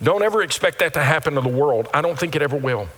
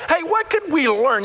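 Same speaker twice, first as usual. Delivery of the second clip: high, bright teacher's question; teacher asking the class; 11 semitones higher than usual. Pretend. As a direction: pitch it high like a teacher prompting. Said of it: "you might say".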